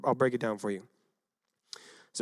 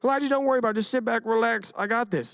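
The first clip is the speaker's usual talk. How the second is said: higher, easygoing don't-worry voice; mock laid-back reassurance; breezy reassurance; 8 semitones higher, faster than usual. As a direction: brush it off like it's nothing. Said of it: "he doesn't just say".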